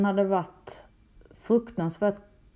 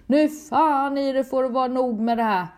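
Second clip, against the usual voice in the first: mock furious threat, slowed and raised; rasande skrik; 4 semitones higher, 3 dB louder than usual. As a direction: voice the husband's rage, not at full force. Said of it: "och skriker". (återgiven ilsken hotfull röst)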